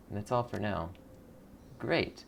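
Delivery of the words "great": ordinary talk, nothing marked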